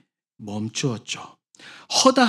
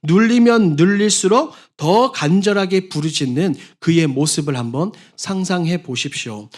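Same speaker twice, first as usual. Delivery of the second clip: ordinary talk, nothing marked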